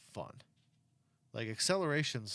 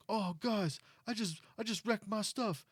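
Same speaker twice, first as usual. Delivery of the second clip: high, whining mock streamer voice; whiny crash guy; 11 semitones higher than usual. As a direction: play him as a dazed whiner. Streamer after crashing car.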